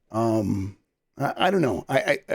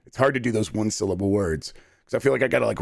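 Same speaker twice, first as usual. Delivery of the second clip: ordinary delivery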